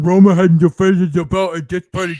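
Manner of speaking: loud, garbled mock complaint; mocking naysayers. Co-host imitating naysayers griping about the title match, mocking loudly. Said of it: "here's all the naysayers going".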